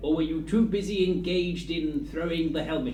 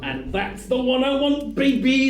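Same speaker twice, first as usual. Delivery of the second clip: higher and louder, thrilled; excited; 7 semitones higher, 4 dB louder than usual. Real, not acted. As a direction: cheer the lucky pick. Excited about elixir choice.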